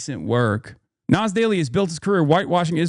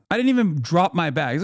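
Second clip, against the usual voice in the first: normal talking voice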